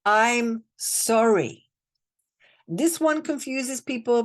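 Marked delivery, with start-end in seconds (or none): 0.0-1.7 very slow, drawn-out I'm sorry; heartfelt mantra, slowed right down; slow sincere apology. Shown as a demonstration. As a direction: let sorry sink in slowly. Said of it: "Then you say".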